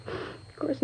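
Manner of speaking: breathy whisper of a secret; conspiratorial whisper; whispered aside. Acted. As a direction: let it slip under your breath. Gossipy witness makes sly aside.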